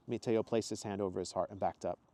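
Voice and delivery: plain reading voice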